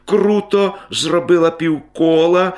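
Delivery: ordinary delivery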